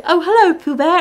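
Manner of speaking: high, loud mock-British child greeting; British accent. Film fan mimicking Christopher Robin greeting Pooh, bad British accent. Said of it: "Where did the British accent come from".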